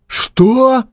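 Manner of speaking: very high-pitched, astonished yelp; shocked outburst. A narrator's reading. Stranger learns Maxim meant to shoot him, high shocked cry. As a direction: shoot the pitch right up in disbelief.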